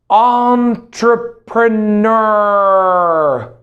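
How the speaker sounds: Entrepreneur stretched out slowly, every syllable clear; slow drawn-out model word. Shown as a demonstration. Teacher modelling the word for students, slow and drawn out. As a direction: draw out Entrepreneur slowly for the class. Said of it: "One more time, please".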